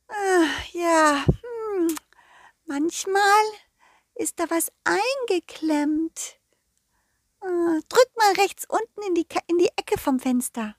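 tiny, high garden fairy; a sweet fairy voice, very high. Acted. Fairy character voice.

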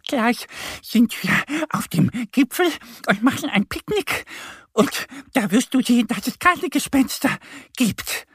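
high, panting little duck voice; außer Atem. A narrator's reading. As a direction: gasp it out high between breaths from the climb. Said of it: "keucht sie". (hoch und keuchend vor Anstrengung)

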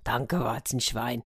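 soft, slow mumble to oneself; hushed, contented muttering; murmured thanks. A narrator's reading. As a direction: murmur your thanks under your breath. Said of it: "und murmelte".

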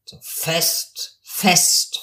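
firm, punched attack on each word; demonstrating a firm onset. Shown as a demonstration. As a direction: start the word firmly, drawn out. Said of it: "Ist er fest? So fest".

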